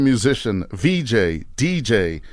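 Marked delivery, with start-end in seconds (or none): none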